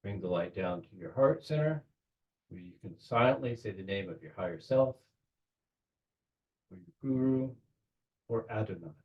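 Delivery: unhurried visualization with long pauses between phrases; measured meditation-leader voice; guided meditation. Real, not acted. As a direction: guide them gently, phrase by phrase.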